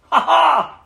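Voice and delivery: high, loud acted "ha ha"; mock divine laugh. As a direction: laugh out in scornful triumph, hammed-up. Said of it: "the one who rules in heaven laughs".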